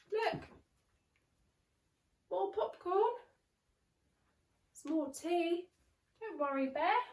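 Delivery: lightly squeaky reassuring mouse. Acted. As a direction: comfort the bear like a tiny mouse. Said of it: "Mouse squeaks".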